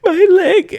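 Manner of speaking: put-on very high, slow, wailing little girl; mock crying child. Fallen toddler crying to the dad about her leg, high wail.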